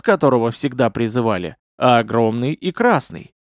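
usual speaking voice

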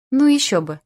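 plain reading voice

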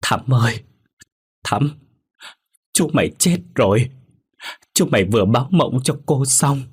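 half-sobbing out the bad news, voice climbing; an aunt tearfully telling her niece of a death, mildly sniffling; sniffling cry. A narrator's reading. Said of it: "rấm rứt khóc".

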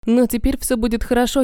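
usual speaking voice

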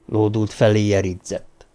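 ordinary delivery, nothing marked

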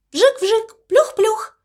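high, ringing echo of the splash; echoing sound. A narrator's reading. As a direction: let it bounce off the walls. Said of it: "отразился звук от стен". (звонкое эхо)

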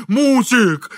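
high, loud call for help; distressed cry. A narrator's reading. Struggling engineer calling on his wife, distressed cry. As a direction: cry out the name plaintively. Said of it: "с печальным криком".

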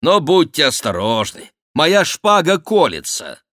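вызов врагам. Acted. Бюсси дразнит нападающих.

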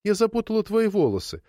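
put-on high, childlike confession; apologetic. A narrator's reading. Granddaughter confessing she tangled the hair, apologetic. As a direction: own up in a sorry tone. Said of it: "извиняющимся тоном произнесла Шарло".

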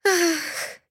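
high breathy gasp-moan ах; indecent moan. A narrator's reading. Heroine lets out an embarrassing moan. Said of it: "услышала я неприличный стон".

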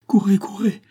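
high, pressing exhortation; urgent urging. Acted. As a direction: send someone off to the rescue. Urges him to save son.

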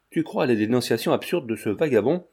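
plain reading voice

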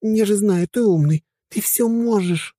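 high, sweet pleading voice; pleading girl. A narrator's reading. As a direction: coax him like a girl. Helga pleading.

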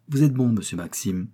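plain reading voice

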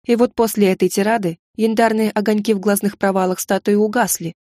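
usual speaking voice

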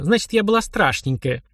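игривая обида повышенным голосом; надуйся понарошку, чуть выше обычного; притворное огорчение. A narrator's reading. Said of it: "притворно огорчилась Марина". (притворно обиженно, повыше)

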